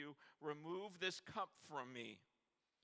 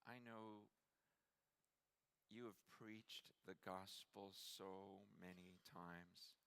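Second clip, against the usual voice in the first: hushed sincere appeal; 6 dB quieter, slower than usual. Acted. Preacher quietly asking the congregation, soft and slow. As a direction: ask it gently, letting each question land. Intimate appeal before the climax.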